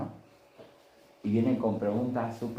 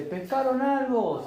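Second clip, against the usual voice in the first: high, slow call carried across the water; call from the shore; 13 semitones higher, 4 dB louder, slower than usual. Acted. Jesus calls from the shore to the boat, high and drawn out. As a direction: hail a boat far out on the lake. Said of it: "se escucha a la orilla".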